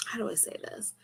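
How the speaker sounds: breathy whispered aside to herself; hushed, unsure whisper. Real, not acted. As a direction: drop to a whisper while searching for words. Searching for words mid-confession.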